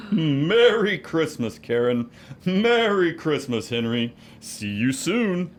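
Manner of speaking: hearty departing Santa; jolly Santa farewell. Acted. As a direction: call out a big warm goodbye.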